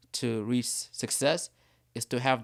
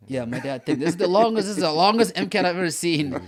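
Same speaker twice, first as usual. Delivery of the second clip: laughing loudly; 4 semitones higher, 7 dB louder than usual. Real, not acted. Joking about the endless MCAT.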